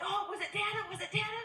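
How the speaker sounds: high, bright eager father hoping for Dada; eager dad. Retold or imitated. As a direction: squeal it like a proud new dad.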